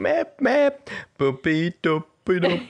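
high squeaky squeaks; squeaky duck sounds. Acted. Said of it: "asynchrone zu singen".

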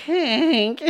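high, thin honk; imitated weak car horn. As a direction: mimic a feeble car horn. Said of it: "It was like".

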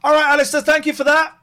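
high, loud cheery goodbye; bright sign-off. Real, not acted. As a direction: wrap up the call brightly. Host wrapping up a caller.

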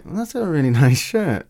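mock sarcastic compliment, laughing. Mimics the woman meaning it sarcastically, a touch of laughter. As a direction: say it like you don't mean it. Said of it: "saying it in a way like".

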